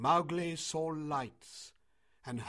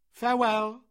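high, loud farewell call; 10 semitones higher, 12 dB louder than usual. A narrator's reading. Mowgli sending Meswa running back, calling out. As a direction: send it ringing after her.

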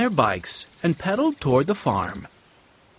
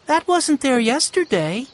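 put-on much higher, light girl's voice, puzzled; young girl Violet; 11 semitones higher than usual. A narrator's reading. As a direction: voice shy Violet, a bit uneasy. Violet's voice in narration.

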